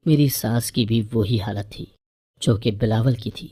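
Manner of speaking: plain reading voice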